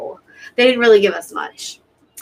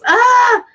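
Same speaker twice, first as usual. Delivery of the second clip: high, loud, airy wail; tearful cry out; 7 dB louder than usual. Real, not acted. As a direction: let out an upset, high "ah". Crying over the show.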